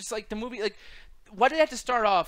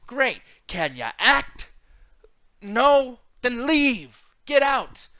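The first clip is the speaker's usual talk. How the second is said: a slow jab, then louder and higher rejection; mock dismissive rejection; 4 semitones higher, 6 dB louder, much slower than usual. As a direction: dismiss the actor like a fed-up casting director. Mock dismissal of crying actor.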